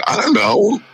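high-pitched mock cluelessness; clueless retold reply. Host answering the security guy, high-pitched and clueless.

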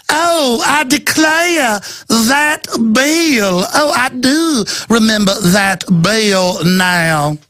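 high, very slow, fake Georgia drawl; affected Georgia accent. Host mimicking the official about the bill, affected Georgia accent. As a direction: put on a hammed-up Georgia accent, drawing words out. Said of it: "This affected fake Georgia accent".

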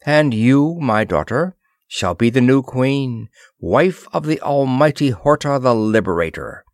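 ordinary delivery

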